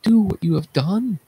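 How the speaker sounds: high, appalled outcry; high-pitched horrified question; horrified cry. Acted. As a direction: cry out in horror at what he has done. Chorus horrified at blinded king.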